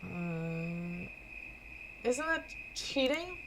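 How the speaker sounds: timid small voice, hesitating; nervous little character. Acted. Voicing shy nervous Gomer.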